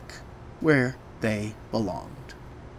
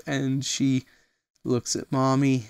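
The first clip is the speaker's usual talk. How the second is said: usual speaking voice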